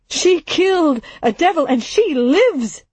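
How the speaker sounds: fervent insistence. Acted. Barnard insists, rising passion.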